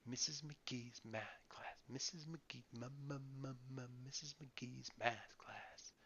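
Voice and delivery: put-on slow, whispered singsong child; whispered repeating. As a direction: whisper the phrase over and over. Little sister repeating in a whisper.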